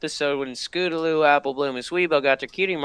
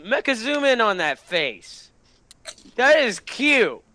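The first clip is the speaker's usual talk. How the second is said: ordinary delivery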